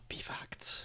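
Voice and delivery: breathless whispered awe; gasp, then whisper it. Pretend. Teasing listeners about bee facts in a gasped whisper.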